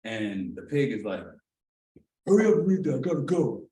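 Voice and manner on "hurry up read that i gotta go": louder, higher pushy cop; impatient cop. Retold or imitated. Officer rushing the arrested man to sign, impatient. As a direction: hurry him like a bored officer.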